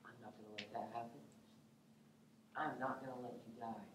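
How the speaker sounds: very soft, low confidential tone; hushed private vow. Acted. Peter pulling Jesus aside to object, hushed and firm. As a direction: drop right down and insist quietly.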